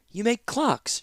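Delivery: high, sweet and light; sugar-coat it; sweetly. A narrator's reading. Princess addressing the eager clockmaker, sweetly high. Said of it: "she said sweetly".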